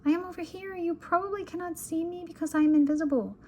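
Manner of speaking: put-on high, small little-girl voice; tiny faraway voice. A narrator's reading. Hidden Lola answering her brother from far away, tiny voice. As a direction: pipe up as if from far off. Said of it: "all we can hear is a tiny voice".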